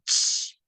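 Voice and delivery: "Ch" voiceless, a bare ch without a vowel; ch sound demo. Shown as a demonstration. Teacher repeating the che sound, voiceless. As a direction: give the plain "Ch" sound only. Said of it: "representación fonética de la che".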